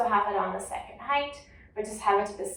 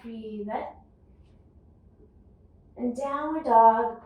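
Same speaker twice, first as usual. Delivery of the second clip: guided yoga cueing. Acted. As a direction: guide the class calmly through each breath. Leads a guided yoga flow.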